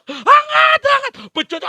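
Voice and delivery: high, loud gloating laughter; master laughing. Acted. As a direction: cackle with cruel delight. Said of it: "majikan itu tertawa". (tawa keras majikan yang kejam)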